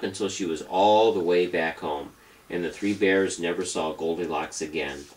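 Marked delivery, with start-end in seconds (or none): none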